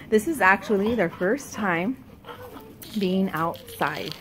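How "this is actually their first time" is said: ordinary talk, nothing marked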